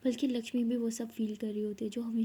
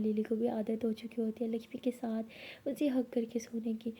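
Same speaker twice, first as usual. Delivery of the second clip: plain reading voice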